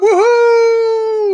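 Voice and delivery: high, loud joyful whoop; excited cheer. Real, not acted. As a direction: whoop with delight. Cheering a winner.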